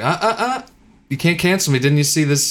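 high, teasing "uh-uh-uh" scolding; mock gotcha. Comedian fending off cancellation, smug mock gotcha.

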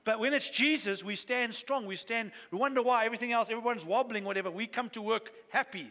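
normal talking voice

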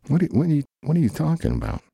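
squeaky what-are-you-talking-about; high surprised squeak. Acted. Friend baffled by the dinner email, high squeak. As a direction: shoot way up high in disbelief.

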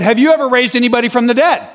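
high, loud, quick-fire question; re-enacted heated comeback; challenging. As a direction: fire the counter-challenge at the preacher. Teller fires challenge at preacher.

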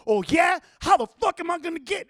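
cocky comeback. Retold or imitated. Man talking back mid-retreat, cocky loud challenge. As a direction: taunt him back, loud and high.

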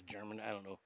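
usual speaking voice

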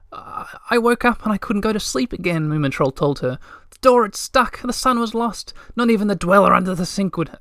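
high, lightly voiced young troll, rushing out his loneliness; a lonely troll finally with a friend; eager Moomintroll. A narrator's reading. Moomintroll telling Little My how lonely he was, high and eager.

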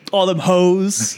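slow, drawn-out Southern accusation; sassy Southern nurse impression; southern accent. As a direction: stretch it out in a high Southern twang. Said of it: "southern accent".

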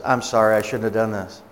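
low-pitched, ashamed apology; imitating an embarrassed, regretful woman; sheepish apology. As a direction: apologize with head hung, voice dropped low. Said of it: "she's hanging her head like this".

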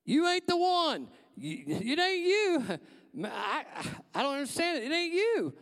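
retold shout. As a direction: yell it out at the visitor, drawn out and stunned. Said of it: "And he starts to shout".

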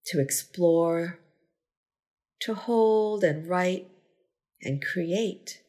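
unhurried meditation guide, long pauses between phrases; measured, spacious gratitude-practice delivery; guided meditation. Acted. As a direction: lead a body scan, giving each phrase space to settle. Leads a gratitude body scan.

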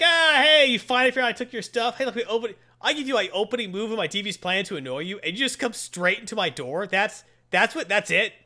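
high, mocking villain taunt; taunting brat. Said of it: "starts taunting Wolverine".

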